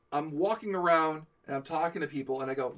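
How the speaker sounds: ordinary delivery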